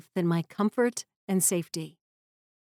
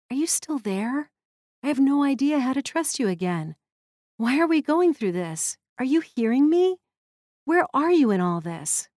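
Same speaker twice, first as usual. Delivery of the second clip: hushed at first, then rising higher and more urgent with each question; pleading prayer; 3 semitones higher than usual. Retold or imitated. Grieving mother at car seats praying to God, pleading. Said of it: "I said a short prayer".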